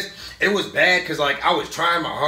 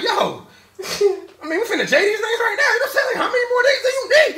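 hyped Wong; 4 dB louder than usual. Retold or imitated. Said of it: "Wong was like".

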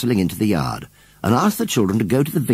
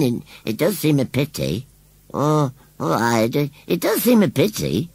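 rustic scarecrow voice; 6 semitones higher than usual. Acted. Scarecrow explains his family burnings to Susan, plainly. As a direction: chatter on like a simple old scarecrow.

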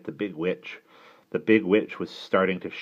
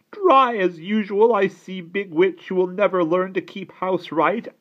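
high, loud, slow criticism; complaining aunt; 13 semitones higher, 9 dB louder than usual. A narrator's reading. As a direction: pick on the housekeeping, high and loud.

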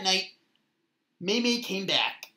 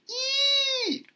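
long high-pitched squeal; story scream. A narrator's reading. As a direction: squeal high, held on one note. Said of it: "Mei Mei screamed".

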